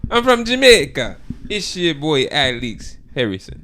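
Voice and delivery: slow, put-on Jamaican accent; hammed-up Jamaican accent. Shown as a demonstration. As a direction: attempt a drawn-out Jamaican introduction. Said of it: "The Jamaican accent?".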